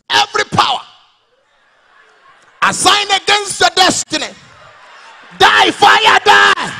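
very loud, high prayer shouts; hammed-up Pentecostal fire prayer; fiery MFM prayer. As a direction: bark fire prayers like a deliverance pastor. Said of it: "hear something like this".

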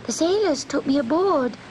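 high, small kitten voice. A narrator's reading. Kitten Gobelino explains himself to the witch, high and small. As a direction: plead like a stranded kitten.

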